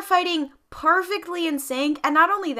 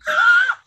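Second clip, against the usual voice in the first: very high, loud gasp; shocked gasp; 6 dB louder than usual. Real, not acted. Co-host reacting to the AirPods joke, shocked gasp. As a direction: gasp sharply and audibly.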